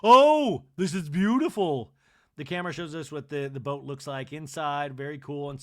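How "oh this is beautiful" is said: high, loud swooning oh; delighted gasp. Retold or imitated. Kathy entering the salon, gushing loudly. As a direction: gush over the boat. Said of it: "She's like".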